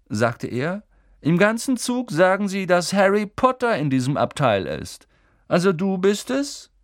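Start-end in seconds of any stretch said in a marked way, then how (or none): 1.2-6.7 high, sneering schoolboy voice; arrogant boy Malfoy. A narrator's reading. Malfoy sizes up Harry in the compartment, sneering. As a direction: look down on him from a height.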